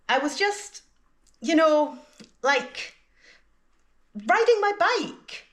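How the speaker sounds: high, pauses around "you know" and "like"; hesitant filler words. Shown as a demonstration. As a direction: stall on "you know, like" while finding "riding". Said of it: "you can use filler words to give yourself some time to think".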